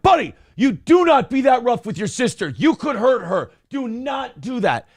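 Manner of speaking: firm telling-off, hammering each word; retold parental yell. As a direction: lay down the law to your son. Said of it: "I had to yell".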